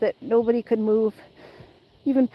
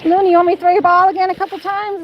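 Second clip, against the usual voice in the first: talking to the dog; 6 semitones higher, 4 dB louder, faster than usual. Real, not acted. High fast baby talk to dog.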